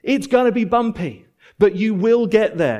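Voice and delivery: high, ringing encouragement; preacher calling out hope to the wanderers; encouraging call. Acted. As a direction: cheer them on toward the finish. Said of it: "We'd say".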